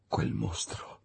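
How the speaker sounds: unvoiced whisper on quel mostro; whispered dread. A narrator's reading. Narrator whispering quel mostro in fear. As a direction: hush it like a secret fear.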